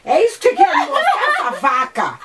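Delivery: high pitch cracking with laughter; laughing in disgust. Real, not acted. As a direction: laugh out the complaint.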